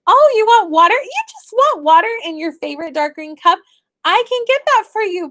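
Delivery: high bright sing-song praise; sweet mommy voice. Retold or imitated. Mother praising her daughter for asking nicely, high sing-song. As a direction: coo it back to a small child.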